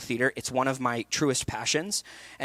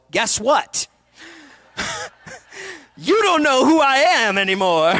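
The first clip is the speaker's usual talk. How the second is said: high, loud mock Rastafarian chef voice; funny Rastafarian chef; 11 semitones higher, 5 dB louder than usual. Coach imagining a call for a Rastafarian chef, hammed-up and loud. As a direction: ham up a Rastafarian chef accent with a laugh. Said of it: "And me go".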